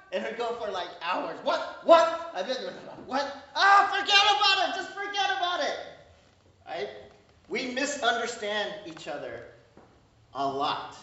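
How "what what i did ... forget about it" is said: rising to a frustrated shout, much higher and louder; exasperated dad. Retold or imitated. Dad who can't understand his mumbling son gives up, shouting. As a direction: give up loudly in exasperation. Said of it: "my dad would be".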